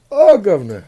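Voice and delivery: high-pitched cartoon British accent; loud, silly British impression; mock British accent. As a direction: ham up a British "guvnor" voice.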